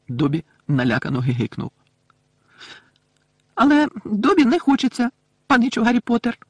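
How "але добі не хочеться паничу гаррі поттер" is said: high, loud elf squeak; little elf piping up emphatically; Dobby's normal elf voice. A narrator's reading. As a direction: back to full squeaky elf voice. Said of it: "знову нормально заговорив ельф".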